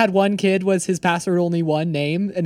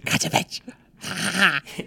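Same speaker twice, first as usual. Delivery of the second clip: breathy, softer gremlin cackle; whispery gremlin taunt with giggles; squeaky gleeful gremlin giggle; 6 dB quieter than usual. Pretend. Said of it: "some sort of gremlin voice you were doing".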